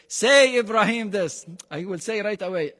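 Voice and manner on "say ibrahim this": higher, louder mock order to himself; mock self-command. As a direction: playfully order yourself around.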